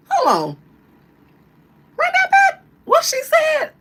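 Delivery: high, loud, shocked yell; shocked shout. Retold or imitated. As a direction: shriek it, stunned and offended. Mock outraged reaction.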